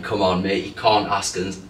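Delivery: ordinary delivery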